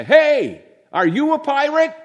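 put-on high, loud child's question; little boy asking. Little boy asks Ron if he's a pirate, high and loud. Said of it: "some little boy got up the nerve to go and say".

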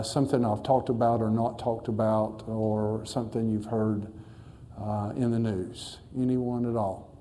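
usual speaking voice

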